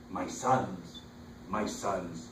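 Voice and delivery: normal talking voice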